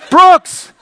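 high, loud imitation of a scolding wife. Wife to husband mid-tantrum, mock scolding. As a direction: call the name out in mock alarm.

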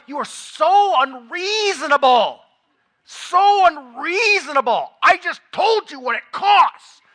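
a husband venting, loud and exasperated; acted-out fury. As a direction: replay the anger at the wife in full. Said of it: "I'm acting out how I felt".

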